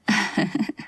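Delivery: brother laughs softly at his sister; soft chuckle. A narrator's reading. Brother teasing his sister, soft amused chuckle. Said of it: "नील हंसते हुए बोला".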